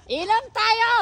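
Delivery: high, loud drinking call; tipsy shout. Real, not acted. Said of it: "para ka nang lasing". (pasigaw na parang lasing)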